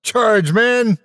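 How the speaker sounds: high, loud charge yell; battle cry. Acted. Quelch ordering his men to charge, loud high yell. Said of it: "cried Quelch".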